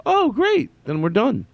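high-pitched mock delight; playful squeal of relief; acted relief to himself. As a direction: pitch way up, pleased with yourself. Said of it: "And I go".

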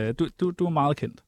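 ordinary talk

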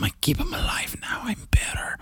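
high whispered aside; high, sly mock whisper. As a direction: breathe it like a secret confession.

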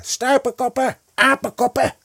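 very high, loud screech; screeched name-calling at full pitch; shrieking. A narrator's reading. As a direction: scream insults after fleeing boys. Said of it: "hoorde zij Ka nog gillen".

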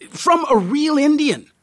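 very high, eager add-on; enthusiastic. A narrator's reading. Guide sells his offer to the tourist, enthusiastically. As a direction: tack it on with high-pitched enthusiasm. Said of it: "you add enthusiastically".

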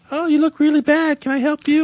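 very high put-on girl voice; squeaky mock-female falsetto; imitated girl. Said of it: "I bump into this girl".

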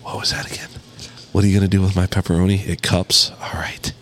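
hushed, whispery creepy caller; mock aroused whisper. Caller asking waitress about pepperoni, hushed mock-aroused whisper. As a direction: whisper it low into the phone.

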